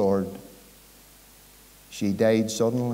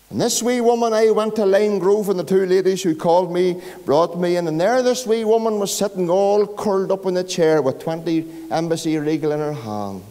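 raised, louder storytelling sermon voice; fervent preaching; 6 semitones higher, 4 dB louder than usual. Real, not acted. Preacher telling the hall about the wee woman, fervent and loud. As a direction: preach it out big to the congregation.

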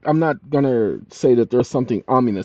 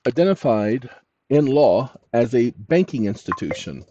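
dictating. Shown as a demonstration. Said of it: "Now, watch this".

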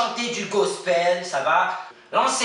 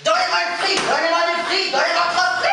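wild celebration; 9 semitones higher than usual. Acted. Contestant celebrates being selected.

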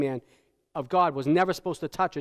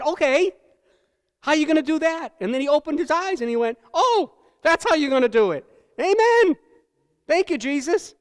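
put-on higher, louder voice of a revived boy, ending in a cry of Amen; boy thrilled to be sent back to his mother, high and excited; amazed boy; 8 semitones higher, 5 dB louder than usual. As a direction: play the boy marvelling at Jesus' plan. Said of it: "And he said".

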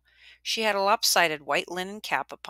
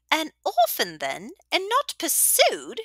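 high, slow, savouring question; relishing old widow; 12 semitones higher, 4 dB louder, slower than usual. A narrator's reading. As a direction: drink in the gossip.

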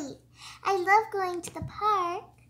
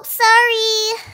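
ordinary talk

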